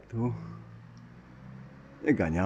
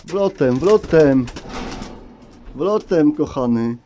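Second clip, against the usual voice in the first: calling out; 5 semitones higher, 9 dB louder, slower than usual. Real, not acted. Urging pigeons through the entrance.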